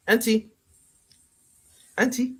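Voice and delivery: high calling voice. Acted. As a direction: call out to her across the room. Calling out to auntie.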